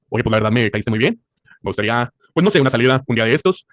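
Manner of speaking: low, slow, put-on seductive voice; deep voice-note flirt. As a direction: play the guy sending a long smooth voice note. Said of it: "esos audios de así largos". (voz grave y lenta de audio coqueto)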